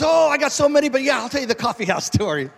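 mimicking an overeager friend jumping at the chance; gushing friend. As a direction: play the excited storyteller who can't wait. Eager storyteller voiced in retelling.

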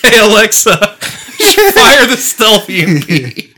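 laughing through a mock order to Alexa; giggling, barely getting the words out; laughing command.

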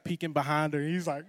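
ordinary talk

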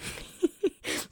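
breathy laugh. Real, not acted. Laughs about time on trip.

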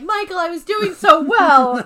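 high, loud wail of complaint; hammed-up dismay; mock protest. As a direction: whine it out loudly, playfully. Acted complaint from a story character.

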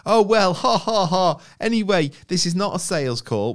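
high, loud fake laugh into sales patter; mock chummy telesales voice; over-friendly cold caller. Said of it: "over friendly bollocks from someone".

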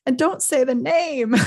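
high, loud, slow plea; drawn-out mock wail; acted sobbing. A narrator's reading. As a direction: beg tearfully, hammed up. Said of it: "sobbed Hagrid".

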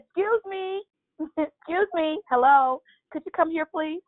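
high-pitched, polite call for attention; acted calling out. Retelling being stuck at the gym, high calling out. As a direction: flag down a worker in a high voice.

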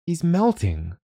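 put-on high urgent girl's plea; a little girl begging her father to see; pleading child. A narrator's reading. Girl pleading with Dad about the snowman, high.

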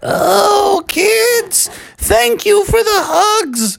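put-on high, slow old-lady voice; weary but kindly granny in falsetto; drawn-out cartoon grandma thanks; battered granny. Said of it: "Granny was laying there flat on her back saying".

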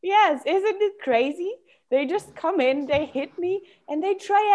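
light, high-pitched disbelief; shifted inner part. Acted. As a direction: lift the pitch in playful wonder. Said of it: "you already shifted your voice in another".

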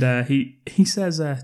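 ordinary delivery

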